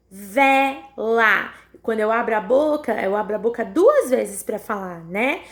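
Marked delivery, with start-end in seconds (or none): 0.1-1.5 "vela" broken as vé... lá, both drawn out; sílabas separadas. Shown as a demonstration. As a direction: pause between the two syllables of "vela". Teacher splits word into syllables. (vela separada em sílabas, vé... lá)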